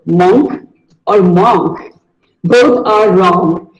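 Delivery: ordinary talk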